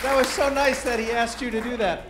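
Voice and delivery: usual speaking voice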